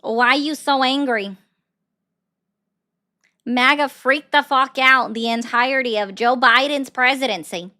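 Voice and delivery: usual speaking voice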